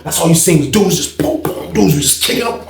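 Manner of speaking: ordinary delivery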